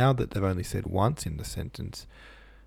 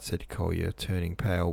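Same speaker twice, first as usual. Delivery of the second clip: usual speaking voice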